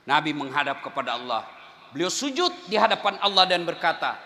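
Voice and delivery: normal talking voice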